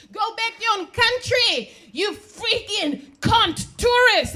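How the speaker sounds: high, loud imitation of an angry heckler; escalated mock rage, drawn out at the end; nasty yelling. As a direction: yell it like a furious Trump supporter. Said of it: "We started yelling at each other".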